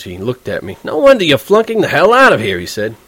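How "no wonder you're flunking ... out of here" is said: high, loud, sneering; angry insult. Acted. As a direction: hit him where it hurts. Furious roommate insulting Holden.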